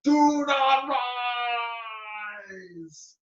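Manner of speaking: high, loud bellow fading at the end; commanding shout. Acted. Bear shouting at the sun a second time. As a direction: roar it at the sun with all your might. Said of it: "and she shouted".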